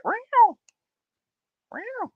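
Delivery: high mock meow; cat meow. Streamer greeting a viewer named Big cat with a meow.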